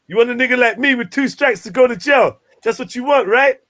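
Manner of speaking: mock American accent, confronting. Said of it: "Was that an American accent".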